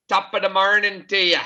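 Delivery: lightly hammed-up Irish greeting; bad Irish accent. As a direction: toss it off in a mock Irish lilt. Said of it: "that's my bad Irish accent".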